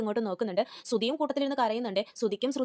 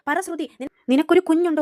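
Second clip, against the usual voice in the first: loud, higher shouted question; angry demand; 5 semitones higher, 9 dB louder than usual. Retold or imitated. Aunt grilling Shruthi in front of everyone, shouting. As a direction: yell the demand at her.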